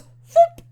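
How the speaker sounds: high, loud cartoon whoop; whoop sound effect. Narrating a flying steel beam, loud cartoon whoop. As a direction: toss out a zany whoop.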